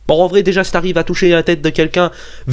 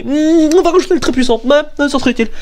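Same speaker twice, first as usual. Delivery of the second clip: higher, mock-pleased musing; Wikipedia editor; 8 semitones higher, faster than usual. Wikipedia writer adding very powerful, mock self-satisfied voice. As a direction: play a smug editor pleased with himself. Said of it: "qu'après fait".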